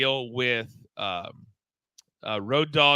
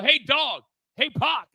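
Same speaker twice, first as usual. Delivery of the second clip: calling out; 11 semitones higher than usual. Retold or imitated. Calling out to wrestlers backstage.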